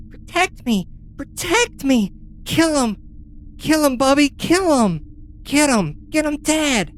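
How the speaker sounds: put-on high-pitched voice of a frightened, bossy little boy; shrill child's squeal, urging on a protector. Little boy on the golem's ankle urging it on, high child voice. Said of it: "is a little boy, maybe seven years old".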